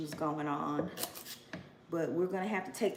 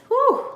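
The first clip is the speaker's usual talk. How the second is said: sharp, high, loud whew of feeling; emotional whew; 12 dB louder than usual. Real, not acted. Nearly crying about lost years, a loud high whew. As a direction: blow out an overwhelmed whew. Overcome thinking of neglected health.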